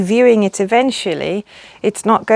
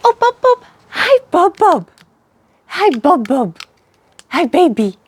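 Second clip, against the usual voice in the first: high, sweet cooing to a pet; 9 semitones higher than usual. Real, not acted. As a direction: coo at the little bird. Greeting the robin sweetly.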